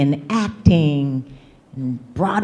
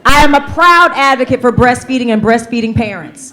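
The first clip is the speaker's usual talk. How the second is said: proud and louder, projected to the room; said with your chest; 8 semitones higher, 8 dB louder than usual. Shown as a demonstration. Leading the audience's repeat, proud and loud. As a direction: belt the affirmation with full chest. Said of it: "Say it with your chest".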